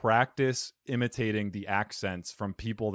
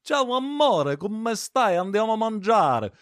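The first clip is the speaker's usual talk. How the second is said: much higher, lazy, drooping southern Italian lilt; southern Italian accent; 10 semitones higher, 4 dB louder than usual. Retold or imitated. Speaking Italian, imitating southern Italians, lazy southern accent. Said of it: "they do have that accent".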